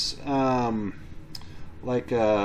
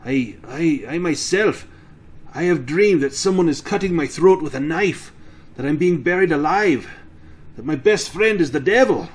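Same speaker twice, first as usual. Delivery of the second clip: loud, raised, dramatic character voice; agitated farmer, higher and louder; troubled farmer recounting nightmares; 3 semitones higher, 5 dB louder than usual. Acted. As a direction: build intensity through each horror.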